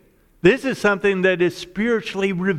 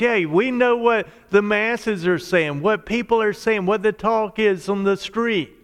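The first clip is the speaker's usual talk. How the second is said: ordinary talk, nothing marked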